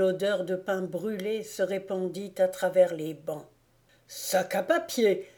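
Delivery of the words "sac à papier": startled outcry. A narrator's reading. Old schoolmaster to class, startled outcry. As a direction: cry out as if leaping from your chair. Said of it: "s'écria le père Krauk en bondissant".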